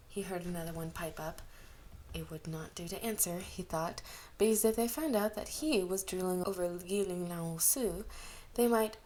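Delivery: ordinary delivery, nothing marked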